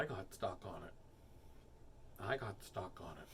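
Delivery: low, soft excuse; deadpan repetition. Pretend. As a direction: repeat it quietly like a stuck record. Stuck-record repetition of excuse.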